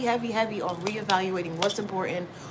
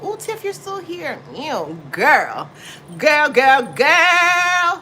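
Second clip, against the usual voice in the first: excited greeting; 8 semitones higher, 10 dB louder, much slower than usual. Real, not acted. Greeting her friend Tiff, loud excited squeal. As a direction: gush over a friend walking in.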